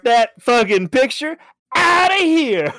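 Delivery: high, loud mock outrage; hammed-up bossy shout; shouted order. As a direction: yell it like you're ordering removal men. Acting out emptying the castle.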